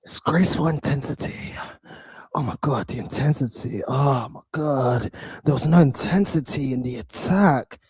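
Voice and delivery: hammed-up mock despair; mocking pundit imitation. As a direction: parody the dramatic headline in an airy low tone. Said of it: "Put some bass in your voice".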